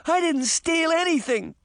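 very high, defensive protest; high-pitched indignant denial; crying out. A narrator's reading. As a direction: cry out your innocence, pitch way up. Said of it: "Sabrina cried".

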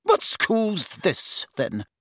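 high, slow questioning matron; inquisitive matron. A narrator's reading. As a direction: probe the visitor, starting high. Matron questions the visitor sharply.

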